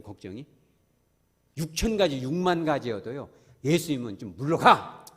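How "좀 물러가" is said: loud, firm order to leave; commanding rebuke. Retold or imitated. Jesus casting out worries, loud command. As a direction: banish it with one word. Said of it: "하면 물러가는 거예요".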